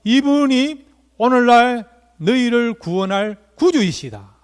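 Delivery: loud, high, slow announcement; ringing, drawn-out declaration; angel's proclamation. Retold or imitated. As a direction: proclaim it grandly like a heavenly herald. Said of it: "그런데 천사가 뭐라고요?".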